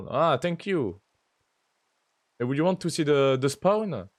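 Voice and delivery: English with a thick French accent. Real, not acted. Streamer offering Lisa a tour, heavy French accent. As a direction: speak English like a true Frenchman. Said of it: "le pire accent anglais".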